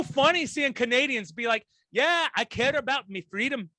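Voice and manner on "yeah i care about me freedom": a light Irish accent, mocking a Canadian; lightly mock-Irish voice. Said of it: "I'm making them sound Irish".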